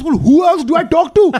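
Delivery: put-on high, loud indignant customer in an Indian accent; mock outraged shout. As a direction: shout it back at the till worker. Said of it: "and he goes".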